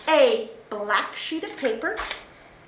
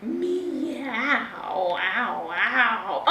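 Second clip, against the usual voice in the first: mock cat meow; 3 semitones lower, 4 dB quieter, much slower than usual. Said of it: "how they say".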